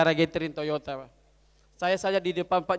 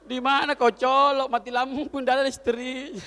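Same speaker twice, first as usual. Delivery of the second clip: exasperated retort; 6 semitones higher, 5 dB louder than usual. Acted. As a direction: belt out the punchline, stretching the words. Punchline retort about no electricity. (seruan kesal, lantang dan dipanjangkan)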